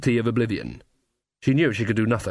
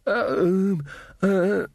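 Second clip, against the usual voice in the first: high, hesitant stammer; stuttering; 7 semitones higher than usual. A narrator's reading. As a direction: falter in a high voice. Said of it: "Magrat stuttered".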